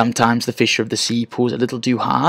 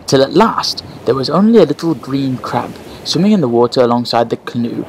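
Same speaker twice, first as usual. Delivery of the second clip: plain reading voice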